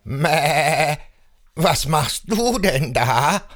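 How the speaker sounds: bleating, wavering goat voice; curious billy goat. A narrator's reading. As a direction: bleat the question inquisitively. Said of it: "sagt ein neugieriger Ziegenbock". (neugieriger, meckernder Ziegenbock)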